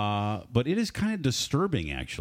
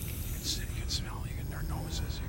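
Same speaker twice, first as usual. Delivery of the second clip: hushed, breathy whisper; 3 semitones higher, 9 dB quieter than usual. Pretend. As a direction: whisper like sneaking past sleepers. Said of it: "quiet, don't wake 'em up".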